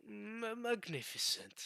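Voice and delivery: high, slow, solemn one-word praise; a hint of a grave Mihawk; all serious. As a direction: draw it out gravely, like a stern swordsman. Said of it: "Like, all serious".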